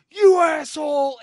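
high, loud furious yell; angry shout. Retold or imitated. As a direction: yell it at the guy who mocked you. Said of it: "he goes".

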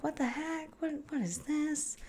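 high, soft, wondering voice; hushed puzzlement. Acted. Observer coming upon a strange animal in the wild, hushed puzzlement. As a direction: softly puzzle over a strange creature.

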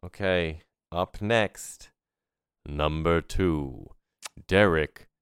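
slow stadium announcer calling out the next player; drawn-out ballpark PA introduction. Pretend. Podcast host introducing the next player, stadium announcer voice. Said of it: "That was pretty good, right?".

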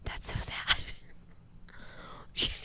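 fighting back tears in a hush; real crying whisper. Real, not acted. Thinking of her parents, whispering while crying. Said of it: "Oh my God, you're crying".

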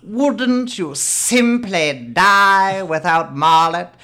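loud, slow, high mock-posh British; roommate imitation. Rita mimicking her literary roommate, hammed-up posh. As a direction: declaim it in an exaggerated proper British accent. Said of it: "did that fucking voice".

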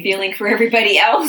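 bursting into a laugh mid-phrase. Real, not acted. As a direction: laugh through the words. Laughter between speakers.

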